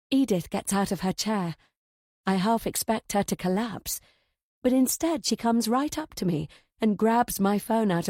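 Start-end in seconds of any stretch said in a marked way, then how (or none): none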